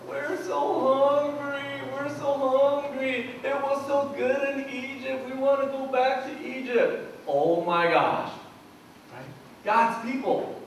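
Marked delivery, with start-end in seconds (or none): 0.1-7.1 whiny Israelites. Retold or imitated. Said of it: "what do the people do".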